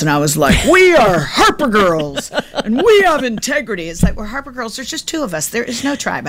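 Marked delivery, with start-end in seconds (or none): 0.7-3.9 mom monologue. Retold or imitated. Mom launching into a monologue at her daughter, high grand proclamation. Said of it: "I'll go into these monologues". 4.2-6.3 high, soft-volume radio DJ patter; hushed, high mock DJ announcer. Mom to her daughter about the family, in a soft DJ voice. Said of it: "I'm talking in D J voice".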